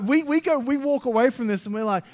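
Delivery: high, loud, rising excitement; excited preaching. Acted. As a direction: build up the crowd. Preaching at full force.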